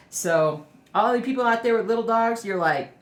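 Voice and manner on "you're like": normal talking voice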